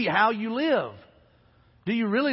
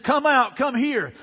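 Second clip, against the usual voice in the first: higher, louder calling voice; retold shout; 5 semitones higher, 4 dB louder, slower than usual. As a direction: call them out of the furnace, imitating the king. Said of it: "flaming furnace and shouted".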